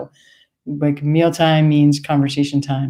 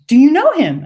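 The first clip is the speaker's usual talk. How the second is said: high, louder, eager asking; high eager question; 11 semitones higher, 7 dB louder than usual. Retold or imitated. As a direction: ask it hopefully like an old lady. Woman's voice retold.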